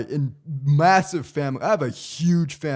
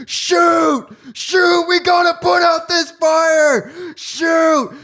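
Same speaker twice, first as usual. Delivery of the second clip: much higher, louder frantic shouting; hammed-up panicked yell of a crazy neighbor; crazy neighbor panicking; 12 semitones higher, 7 dB louder, much slower than usual. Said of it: "there's this crazy guy who's like".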